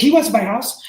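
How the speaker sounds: high, loud, surprised question; surprised cashier. Retold or imitated. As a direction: blurt it out in disbelief. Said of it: "And she's like, she's like".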